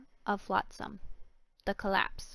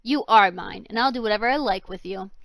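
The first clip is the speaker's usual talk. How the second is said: loud menacing claim; 8 dB louder than usual. Acted. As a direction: boom it over her. Villain claims girl.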